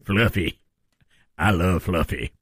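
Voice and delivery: low, slow mock Southern drawl; Southern accent. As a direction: drop low and savor it in Southern. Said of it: "I think it's your Southern accent".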